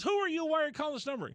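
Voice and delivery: high-pitched imitation of a shrill wife; mock angry woman. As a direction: voice her high and indignant. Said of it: "She goes".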